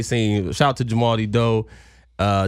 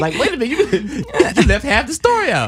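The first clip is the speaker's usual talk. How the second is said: confused follower voice; 3 dB louder than usual. Retold or imitated. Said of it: "quoting a confused follower".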